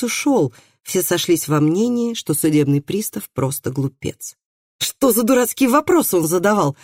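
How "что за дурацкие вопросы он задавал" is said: raised-pitch, indignant question; exasperated exclamation. A narrator's reading. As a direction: exclaim at the stupidity of it. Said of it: "воскликнул Андреас".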